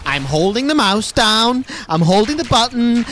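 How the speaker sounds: shouting that he holds the button; frustrated yelling. Real, not acted. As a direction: protest at the broken game. Yelling at the game.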